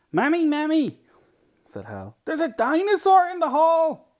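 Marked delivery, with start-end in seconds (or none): 0.1-1.0 put-on high, loud little boy's cry; alarmed child calling. Child alerts Mammy to dinosaur. 2.2-4.1 put-on high, loud little boy's cry; alarmed child calling. Child alerts Mammy to dinosaur.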